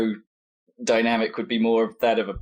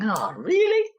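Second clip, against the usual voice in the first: a sharply higher, exasperated whine aimed at the sky; a player groaning at his own bad shot, much higher and exasperated; exasperated curler; slower than usual. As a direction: despair at a missed shot. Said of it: "like, going".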